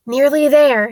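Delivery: lightly acted out-of-breath encouragement; panting. A narrator's reading. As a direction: say it as if panting from a climb. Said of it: "Harry panted".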